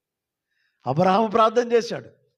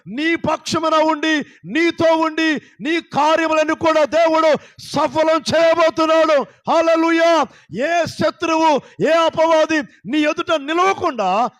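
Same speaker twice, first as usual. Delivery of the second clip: high, loud, slow preaching cry; fiery preaching; 7 semitones higher, 5 dB louder, slower than usual. Real, not acted. Pastor exhorting the congregation, loud fervent preaching. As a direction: proclaim it to the whole church.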